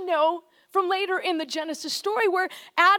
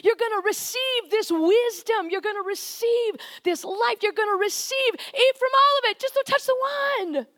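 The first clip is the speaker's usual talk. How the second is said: higher, bright generous invitation; God urging humans to eat from every tree, higher and warmer; 5 semitones higher than usual. Pretend. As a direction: voice God offering everything freely. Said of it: "it's like".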